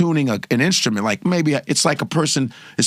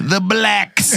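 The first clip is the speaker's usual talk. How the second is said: Trump impression; 5 semitones higher than usual. Answering as Trump.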